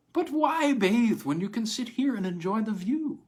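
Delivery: high, breezy dismissal; breezy salesman. A narrator's reading. Agent to buyer, high breezy dismissal.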